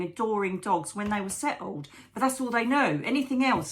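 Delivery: normal talking voice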